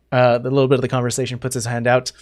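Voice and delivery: ordinary talk, nothing marked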